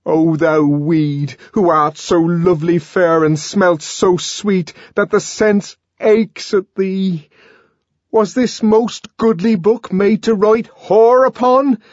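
high, loud, slow theatrical verse; ranting Shakespearean recital, frenzied; raging savage declaiming. Acted. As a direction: declaim bitterly at full voice. Savage resumes his raging verse.

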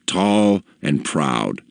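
ordinary delivery

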